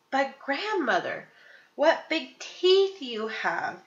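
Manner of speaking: a hint of dread creeping in; slightly quivering. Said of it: "her voice slightly quivering".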